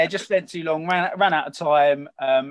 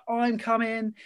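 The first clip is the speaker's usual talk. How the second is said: higher-pitched mock of a loud stepdad; retold insistence, voice lifted; insistent stepdad; 7 semitones higher, slower than usual. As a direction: lightly imitate a stepdad who insists, pitched up. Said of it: "he's like this really loud Geordie".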